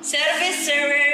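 Tukul impression. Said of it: "suara Mas Tukul".

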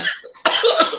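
sharp choking cough; coughing fit. Real, not acted. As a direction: cough hard on a drink. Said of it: "Sorry, I'm choking".

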